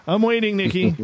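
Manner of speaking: high, loud mock-impatient call; playful impatience. Host calls to Nikki for her late paragraph, playfully impatient. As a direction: call out in teasing, pretend impatience.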